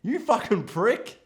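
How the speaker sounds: exasperated outburst, replayed; retold losing it. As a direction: act out being appalled at the screen. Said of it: "I lost my mind when that happened".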